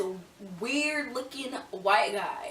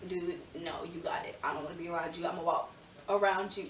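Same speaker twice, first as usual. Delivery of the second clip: muttered brush-off; 4 semitones lower, 9 dB quieter than usual. Retold or imitated. Imagined brushing off the stranger.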